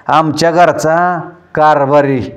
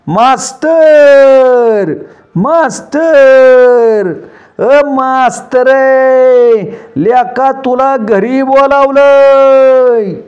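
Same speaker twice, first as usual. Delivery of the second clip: long drawn-out higher call across the field; calling loudly from afar; 7 semitones higher, 4 dB louder, much slower than usual. A narrator's reading. Sadhya calls the master from the road, loud and drawn-out. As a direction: stretch each word and call out to the master far away. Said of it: "साध्या मोठ्यानं म्हणला".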